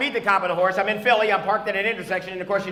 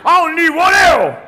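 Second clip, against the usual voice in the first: loud, higher swaggering shout; mock macho boast; 8 semitones higher, 12 dB louder than usual. Comic mimicking the hunter, shouting his boast. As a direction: brag like a tough hunter.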